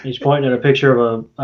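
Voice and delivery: usual speaking voice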